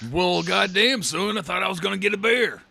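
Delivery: a hammed-up Texan. Joking about wrestlers, hammed-up southern accent.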